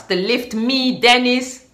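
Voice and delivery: ordinary talk, nothing marked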